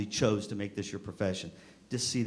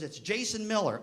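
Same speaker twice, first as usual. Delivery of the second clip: put-on kid's reply; 8 semitones higher than usual. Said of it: "that's a kid I hated".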